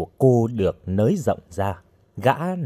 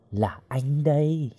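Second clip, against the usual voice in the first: slow, leering murmur with a soft snicker; sly low-volume murmur; 4 semitones higher, 6 dB quieter, slower than usual. A narrator's reading. Lecher announcing himself to the captive, softly leering. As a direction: creep in softly, drawn-out words. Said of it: "nói nhỏ".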